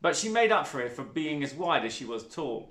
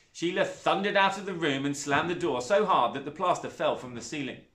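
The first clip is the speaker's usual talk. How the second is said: usual speaking voice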